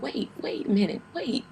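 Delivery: high, loud, desperate pleading; panicked plea. Retold or imitated. Pleading with the doctor in labour, high panicked cry. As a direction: beg them to stop, voice cracking. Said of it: "I cried so hard".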